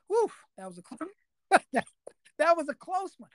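exaggerated phew of a narrow escape; retold relief. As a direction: laugh off a near miss as you act it out. Retells relief after sneaking out.

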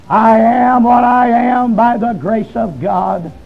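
very slow, level preacher's declaration; drawn-out solemn quote. Retold or imitated. Old preacher addresses the congregation from the pulpit, slowly and solemnly. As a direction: draw each word out on an even note.

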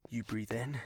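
soft unvoiced whisper. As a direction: whisper the instruction. Breathing exercise.

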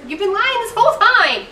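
high, loud incredulous retort; exasperated retort. Retold or imitated. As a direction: fire it back, high and loud. Mocking the character's line.

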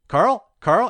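high, questioning call of a name; retold call to someone who went silent. Jesse calls to the man behind the door, questioning.

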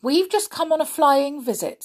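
put-on mildly plucky young hero; bold. A narrator's reading. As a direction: pipe up boldly to the giant. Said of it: "said Peter boldly".

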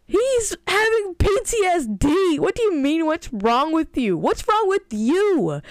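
high, loud, slow indignant shout; exasperated outburst. Retold or imitated. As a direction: rant back at her in disbelief. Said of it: "I'm like".

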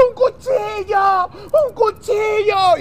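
high, drawn-out mock scream; acted screaming. Man in bathroom starts screaming for a knife, acted screaming. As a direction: cry out slowly for it, high and frantic. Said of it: "Y empieza a gritar".

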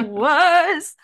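high cracked squeak; voice cracking. Real, not acted. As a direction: voice breaking on the word. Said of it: "my voice".

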